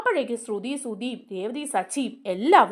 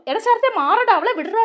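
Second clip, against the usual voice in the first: louder, higher yell to let her go; retold shout; 8 semitones higher, 6 dB louder than usual. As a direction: shout at him to back off. Pulling attacker off, shouting. ("മാറടാ" എന്ന് ഉറക്കെ വിളിച്ചു പറയുന്നു)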